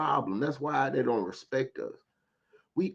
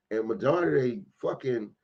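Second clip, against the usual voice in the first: ordinary talk, nothing marked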